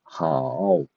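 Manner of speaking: hao dipping low then rising; third tone. Shown as a demonstration. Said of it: "falling and rising tone".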